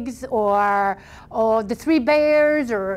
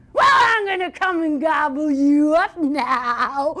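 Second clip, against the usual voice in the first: slow, drawn-out threatening shout; menacing troll; 3 semitones higher, slower than usual. Acted. Troll threatening the biggest goat, roaring. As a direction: roar a threat, dragging out the words.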